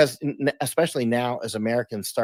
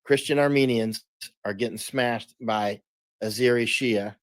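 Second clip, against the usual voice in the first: slowly, with pauses between phrases; slow, deliberate. Real, not acted. Guest laying out a tangled conflict for the host, slowly and deliberately. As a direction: spell it out slowly so it sinks in. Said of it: "say this really slowly to let it sink in".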